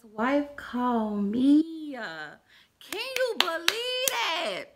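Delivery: high, slow, loud disbelief; telling viewers, high slow astonished; dramatic reveal. Real, not acted. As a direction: drag it out for effect.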